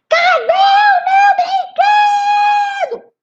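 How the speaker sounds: put-on high shrill-free child yell, drawn out slow; a bratty girl screaming for her toy. Little Rita shrieking for her lost toy, loud and slow. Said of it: "ela só vivia gritando".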